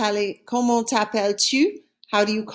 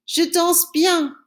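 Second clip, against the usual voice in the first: lightly voiced proud answer; 7 semitones higher, slower than usual.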